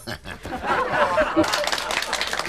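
airy laugh, way up high; high breathy laugh. Real, not acted. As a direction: cackle at your own joke. Host laughs at own joke.

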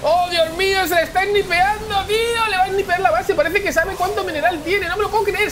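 high-pitched, astonished play-by-play outburst; excited shouting. Real, not acted. Caster watching the Protoss base get sniped, excited shouting. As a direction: call it in a high, breathless rush of disbelief.

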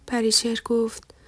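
plain reading voice

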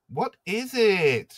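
high, slow coy question. Acted. Lissa asks the Beast what he hides, coy. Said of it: "she asks coyly".

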